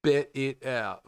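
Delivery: high-pitched echo of an advert; mimicking betting ad. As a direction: slowly parrot the ad's catchphrase.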